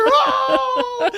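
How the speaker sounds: high, loud mock wail of longing; imitated howl. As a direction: wail at the food with laughing sobs. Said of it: "She was just howling at this food".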